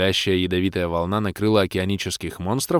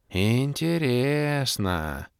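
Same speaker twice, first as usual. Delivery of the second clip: very slow, drawn-out, higher; thoughtful drawl; 4 semitones higher, 3 dB quieter, much slower than usual. A narrator's reading. Orbit musing at the demoness, slow drawn-out voice. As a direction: stretch the word out musingly. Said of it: "задумчиво протянул Орбит".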